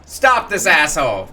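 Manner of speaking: put-on high, excited kid voice; a kid egging on his defender; cheering child. A child urging Tom to stop the bully, cheering.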